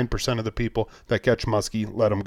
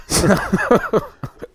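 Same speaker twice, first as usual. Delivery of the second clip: real laugh; 8 semitones higher than usual. Real, not acted. Laughing at co-host's walleye joke.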